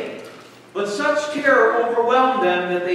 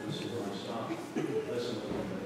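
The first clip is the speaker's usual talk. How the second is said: much lower and softer, solemn; hushed heavenly voice; 11 semitones lower, 14 dB quieter than usual. Acted. Voice from heaven to the disciples, low and hushed. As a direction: hush it like a voice from the cloud. Voice from the cloud speaks.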